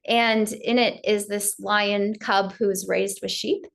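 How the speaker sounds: ordinary delivery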